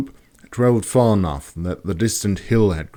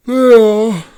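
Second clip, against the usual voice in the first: said through a yawn; 11 dB louder than usual. Acted. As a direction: yawn the words out. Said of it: "Master Rope yawned".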